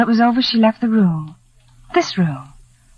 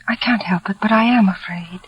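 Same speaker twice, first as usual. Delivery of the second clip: breathless, frightened whisper. Acted. Laura confessing her fear to John, frightened whisper. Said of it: "I'm afraid".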